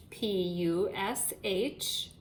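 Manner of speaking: P U S H letter by letter, slow; spelling aloud. Shown as a demonstration. Teacher spelling push for second graders, slowly. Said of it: "the word push".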